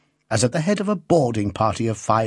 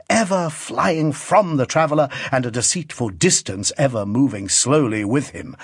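ordinary delivery, nothing marked

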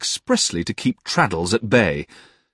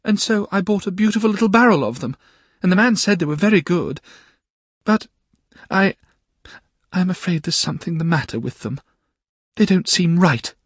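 put-on much higher, worried young wife; anxious Dora; 12 semitones higher than usual. A narrator's reading. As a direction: confess the bad oysters nervously. Dora's voice in the story.

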